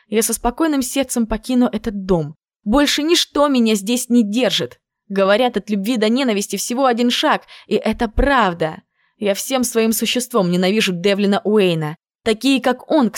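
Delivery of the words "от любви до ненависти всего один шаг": plain reading voice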